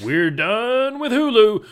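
loud, high, drawn-out sign-off; mock alarmed announcement. Parent dodging kids' questions about streaming, loud mock announcement. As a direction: sing out the escape line, stretched and high.

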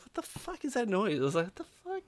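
baffled reaction. Retold or imitated. Reacting to the strange distant noise.

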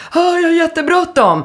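high-pitched, hammed-up frantic wail; mock panicked hurry. Passenger hopping into taxi late, mock panic.

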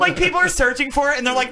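normal talking voice

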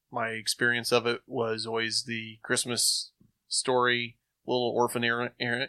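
normal talking voice

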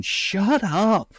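high-pitched mock "shut it" outburst; exasperated mock scolding. Mock scolding his son on set.

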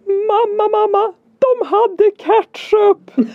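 imitating a thrilled child, very high and drawn out; excited child's shout. Son runs to mother after lunch with big news, excited shout. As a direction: squeal it like an overjoyed kid, half laughing. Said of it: "springandes mot mig och skriker så här".

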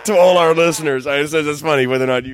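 loud, mock-grand insistence; a hammed-up proclamation to the audience; insistent announcement. Real, not acted. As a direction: declare it to the listeners with emphasis. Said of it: "announces to listeners".

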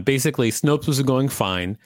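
ordinary talk

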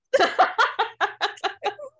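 a rising peal of laughter; high laughing burst. Real, not acted. Big laugh.

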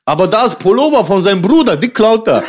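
halting mother defending her son; broken German. Retold or imitated. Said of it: "mit gebrochenem Deutsch".

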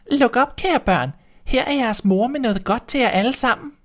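high, thin put-on sweetness; wolf squeaking to sound like mother; wolf faking a thin voice. A narrator's reading. Wolf pretending to be mother at the door, high and thin. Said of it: "så blev den stemme ganske tynd og blid".

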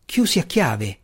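ordinary delivery, nothing marked

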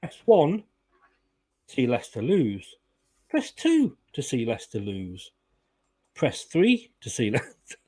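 deadpan recorded-menu announcer; phone menu voice. Pretend. Mock automated phone menu.

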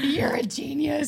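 high, drawn-out gushing praise; mock awe. Wife praising husband for fixing the phone, hammed-up awe. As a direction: stretch it out in exaggerated adoration.

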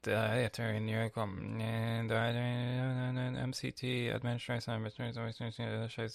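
reading a search result aloud. Acted. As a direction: read the snippet off the screen. Reads an article aloud.

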